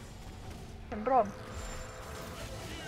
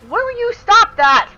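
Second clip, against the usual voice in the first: exasperated; 11 semitones higher, 8 dB louder, slower than usual. Real, not acted. Exasperated at teammate.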